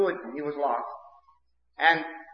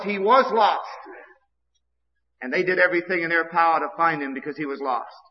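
ordinary talk, nothing marked

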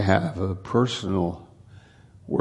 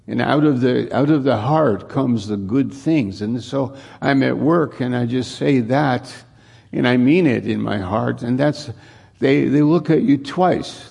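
loud, higher preaching climax; full-force preaching; 4 semitones higher, 4 dB louder than usual. Acted. Pastor urging believers to speak good things at work, preaching loud and high. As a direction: lift the congregation with a loud high-pitched preach.

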